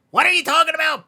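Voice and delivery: loud, high disbelieving outburst; incredulous shout. Acted. Brother shouting in disbelief at the podcast news. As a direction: blurt it out, totally thrown.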